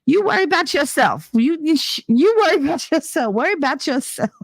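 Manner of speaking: put-on high-pitched toddler telling off dad; imitated bossy little girl. Little girl in car seat telling dad off, imitated bossy child. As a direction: scold in a high small-child voice. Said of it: "She's like".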